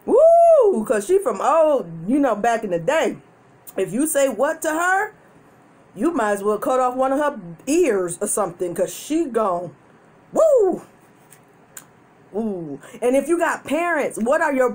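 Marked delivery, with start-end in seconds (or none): 0.1-0.9 excited whoop, high and loud; loud high woo. Real, not acted. Laughing exclamation after story. 10.3-10.8 excited whoop, high and loud; loud high woo. Real, not acted. Laughing exclamation after story.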